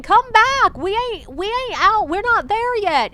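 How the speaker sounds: loud, high-pitched mock holler; shouting. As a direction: imitate a girl yelling after her group. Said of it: "this girl who's just shouting".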